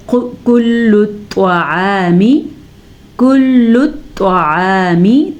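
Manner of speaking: slow melodic tajweed chant; Quran recitation. Shown as a demonstration. Teacher demonstrates the verse for students, slow chant. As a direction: draw each word out in a chant.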